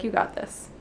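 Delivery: whispered reassurance. Retold or imitated. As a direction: breathe it reassuringly. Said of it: "He's like".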